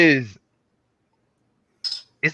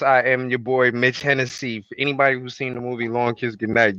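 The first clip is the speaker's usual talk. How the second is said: normal talking voice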